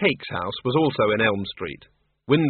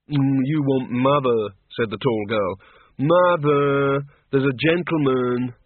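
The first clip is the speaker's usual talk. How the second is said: put-on slow gentle young woman; slower than usual. Tall daughter calls mother.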